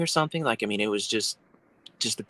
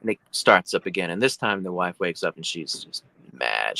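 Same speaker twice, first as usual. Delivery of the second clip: ordinary talk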